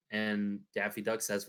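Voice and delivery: usual speaking voice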